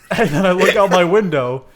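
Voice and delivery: loud laughing narration. Real, not acted. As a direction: tell it while cracking up. Laughing through absurd story.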